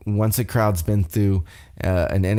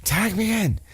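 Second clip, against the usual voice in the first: mock yell; 11 semitones higher than usual. Kevin demanding a tag from his partner, mock yell. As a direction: imitate a wrestler calling for the tag. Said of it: "Kevin's yelling".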